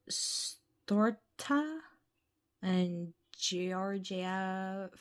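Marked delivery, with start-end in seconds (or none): none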